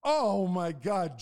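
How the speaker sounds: loud, drawn-out exclamation; emphatic exclamation. Real, not acted. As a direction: stretch it out, loud and high, for emphasis. Emphatic answer to dry or humid.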